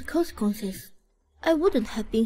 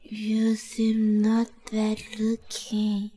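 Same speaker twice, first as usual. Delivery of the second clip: very slow, tipsy admiring murmur; drunk; 3 semitones lower, much slower than usual. Acted. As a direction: stretch the words out woozily. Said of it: "since you're so drunk".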